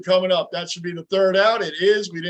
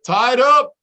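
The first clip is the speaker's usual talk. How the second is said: excited shout; 6 semitones higher, 9 dB louder than usual. Real, not acted. Fan reacts to tied game.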